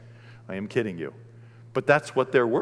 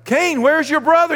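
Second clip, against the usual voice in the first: much higher, loud booming call; God calling out; 10 dB louder than usual. Acted. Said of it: "God comes and he's like".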